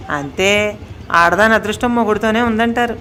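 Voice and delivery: ordinary delivery, nothing marked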